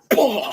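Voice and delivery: high, loud burst imitating a blow; explosive mouth-made impact sound; imitated punch noise. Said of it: "He just went".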